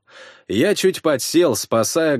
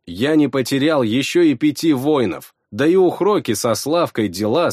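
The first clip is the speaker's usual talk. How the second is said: ordinary delivery, nothing marked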